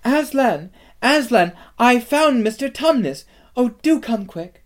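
put-on high, loud, urgent girl's call; excited shouting. A narrator's reading. Lucy rushes upstairs to Aslan with news, excited shouting. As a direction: shout high for someone to come at once. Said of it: "shouting out".

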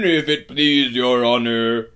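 loud, booming giant voice; deferential giant. A narrator's reading. Giant addresses Aslan as his superior, booming deference. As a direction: boom it out respectfully. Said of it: "said the giant".

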